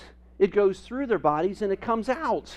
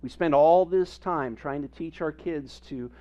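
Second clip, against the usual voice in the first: normal talking voice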